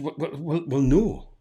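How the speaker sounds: high, stammering imitation of a flustered agent; acted-out awkward backtracking; flustered refusal.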